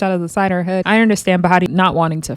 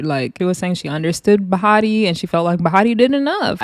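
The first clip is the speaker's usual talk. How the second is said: ordinary talk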